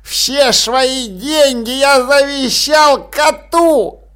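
put-on gloating old man. A narrator's reading. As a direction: rub it in their faces. Old man taunts heirs.

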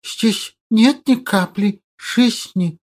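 quiet, slow lisping hiss; hissing creature voice. Acted. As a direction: hiss like a small monster. Hissing creature sidekick speaks.

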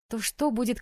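plain reading voice

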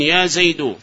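"زيد" ending in a bare -u; damma without tanween. Shown as a demonstration. Teacher models the correct call, "زيد" said Zaydu. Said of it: "البناء على الضم من غير تنوين".

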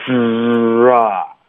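之 pouted tight, opening into a long relaxed 啊. Shown as a demonstration. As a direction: hold 之 then release wide into 啊. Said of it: "松、". (从很紧的 之 放松到张开的 啊)

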